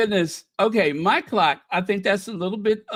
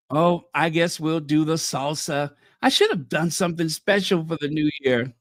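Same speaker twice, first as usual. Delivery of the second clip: usual speaking voice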